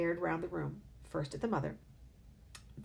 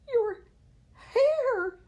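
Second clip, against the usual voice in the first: piercing high shriek on hair; 12 dB louder than usual. A narrator's reading. Mother shrieking at her husband's hair, very high and loud. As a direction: scream it in horror. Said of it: "the mother was shrieking".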